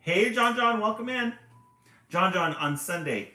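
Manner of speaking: loud excited shout-out; calling out. Real, not acted. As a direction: shout a question to a viewer. Greeting a viewer on stream.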